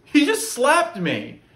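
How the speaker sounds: high, loud, slow outrage; indignant complaint, voice up high; just got slapped. Acted. Reporting the slap, outraged.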